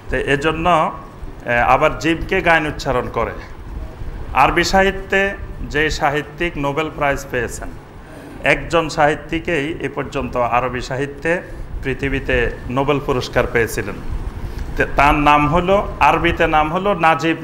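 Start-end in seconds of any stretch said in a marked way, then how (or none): none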